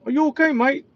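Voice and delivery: high-pitched imitation British accent; thick British accent. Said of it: "in a thick British accent".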